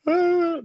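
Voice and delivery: hammed-up high scream of panic; scared scream. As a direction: yelp like a terrified kid. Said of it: "I would've been like".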